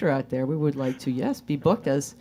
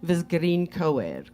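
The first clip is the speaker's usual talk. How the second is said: put-on Russian accent. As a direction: explain it as Svetlana in Russian accent. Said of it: "Where did you learn the accent?".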